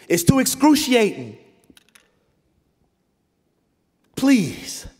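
loud, high, slow desperate begging; anguished Jesus pleading. Acted. Jesus pleading with the Father in Gethsemane, anguished loud begging. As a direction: beg God with everything, near breaking. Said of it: "he's like".

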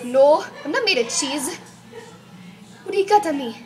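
loud mock indignation; indignant teacher. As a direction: protest loudly as an offended pretend teacher. Teacher snapping at pupils.

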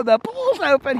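high-pitched, amused disbelief; mock incredulous echo. Repeating the joke back to Alex, high mock disbelief. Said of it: "I go".

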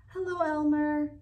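voice trick call. A narrator's reading. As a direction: sing out from the tree. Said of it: "Elmer called back".

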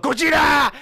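put-on horrible Japanese accent. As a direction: shout it in a hammed-up Japanese accent. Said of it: "doing a horrible Japanese accent".

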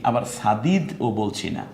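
normal talking voice

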